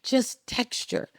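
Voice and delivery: just texture, slow and soft; stress on texture. Shown as a demonstration. Coach stressing his key word to the host, slow and soft. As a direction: lean gently on just texture. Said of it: "put what I call on it texture".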